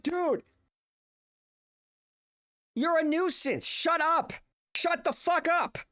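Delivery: high, exasperated shout; angry telling-off. Retold or imitated. Telling off the honking driver, high angry shout. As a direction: snap at him to shut up.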